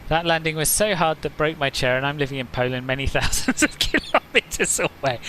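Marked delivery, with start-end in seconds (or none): 2.9-5.2 laughing. Real, not acted. Laughs while reading a chat joke.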